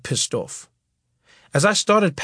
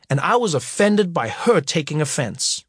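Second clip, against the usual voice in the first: plain reading voice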